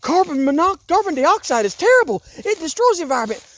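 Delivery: high, mocking voice of media pundits; hammed-up mockery of the mainstream media. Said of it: "the idiots in the mainstream media".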